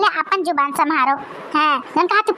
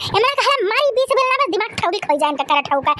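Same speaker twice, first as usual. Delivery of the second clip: ordinary talk, nothing marked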